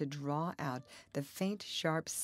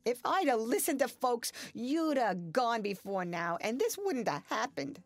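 bitter outburst; 8 semitones higher, 5 dB louder than usual. A narrator's reading. Zena's final outburst.

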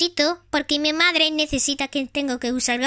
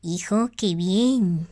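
mother toy voice; 8 semitones lower, slower than usual. Pretend. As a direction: play the gentle mother of Tag. Plays Tag's mother.

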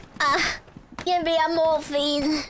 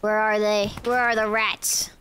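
normal talking voice

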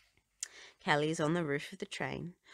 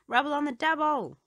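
high, loud barking cry; barked catchphrase; 11 semitones higher, 7 dB louder than usual. A narrator's reading. Rubble shouting his catchphrase, high and loud. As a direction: bark it out with gusto. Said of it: "barks Rubble".

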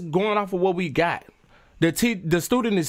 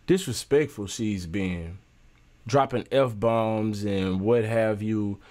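disapproving; 7 semitones lower than usual. Real, not acted. Commentator condemning the student.

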